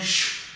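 soft shushing whoosh of a spinning dryer. Pretend. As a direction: breathe out a long sh like a machine turning. Imitates the tumble dryer noise.

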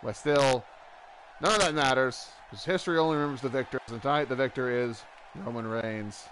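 excited play-by-play call; wrestling commentator. Acted. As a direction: call the match like ringside. Play-by-play of the match.

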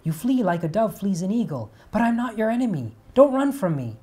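loud pleading. Acted. Apollo begging Daphne not to flee, loud. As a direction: plead as you run after her.

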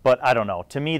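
normal talking voice

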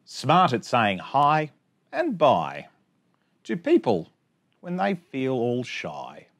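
bouncy sing-song rhyme reading; reading to children. Acted. Teacher reading a rhyming picture book to her class, sing-song. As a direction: read the rhymes out brightly for little kids.

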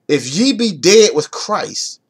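slow, deliberate scripture reading. Shown as a demonstration. Preacher slowing down so the congregation gets it, slow. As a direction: let each word land slowly. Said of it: "I'm gonna read it slow".

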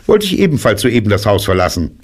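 high, quick rabbit voice; hurried, high-pitched announcement; rabbit character. A narrator's reading. Rabbit says he is leaving too, quick high voice. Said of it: "sagte Kaninchen".

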